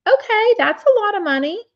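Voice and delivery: high, loud amazed reaction; surprised. Real, not acted. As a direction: gasp at the big sum. Reacting to a big number.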